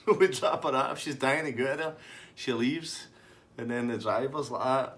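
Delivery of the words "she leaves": normal talking voice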